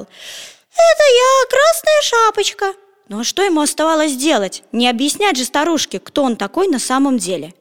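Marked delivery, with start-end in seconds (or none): 0.0-2.7 very high, slow put-on girl's voice; fake girl voice. A narrator's reading. Petya lies to the grandmother, faking a high girl's voice. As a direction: Petya squeaks like a little girl. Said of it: "соврал".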